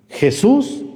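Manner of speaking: "Jesús" pitched high with a throaty J; correct Spanish J. Shown as a demonstration. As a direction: say "Jesús" high, as heh-SOOS. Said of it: "es Jesús".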